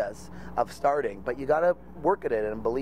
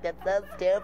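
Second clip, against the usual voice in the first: scornful mother; 7 semitones higher than usual. Retold or imitated. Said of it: "Your mother's looking at me like".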